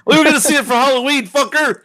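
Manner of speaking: high, fast mock-angry yell; play-shout it, half laughing; mock outrage. Friend hit by a movie spoiler, mock outrage.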